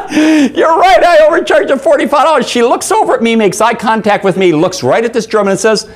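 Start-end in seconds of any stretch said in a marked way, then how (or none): 0.1-2.5 high, loud words through mock laughter; imitated laughing. Waitress admitting overcharge to German customer, high imitated laughing voice. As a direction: say it as if cracking up. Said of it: "She starts laughing".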